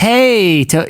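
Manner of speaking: high drawn greeting, cut short. Acted. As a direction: hail a buddy high, then break off. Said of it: "how's your [name]?".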